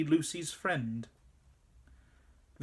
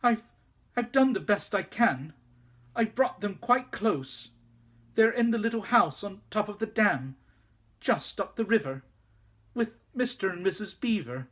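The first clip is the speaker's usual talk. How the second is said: higher, hurried excuses; anxious boy; 6 semitones higher, 3 dB louder than usual. A narrator's reading. Boy excusing himself to the queen, anxious rush. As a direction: rush to justify yourself.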